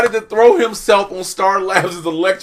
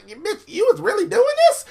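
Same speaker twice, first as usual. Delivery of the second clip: high, fast mock indignation; betrayed villain squeal; faster than usual. Queen of Fables confronts Harley, high indignant squeal. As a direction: squeal it in disbelief.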